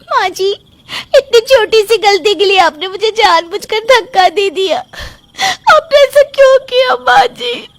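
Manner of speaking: rising, hurt and accusing, slowing at the end; fake wounded accusation. Daughter-in-law fakes hurt to mother-in-law in front of husband, high wounded cry. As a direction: play the victim, voice climbing high.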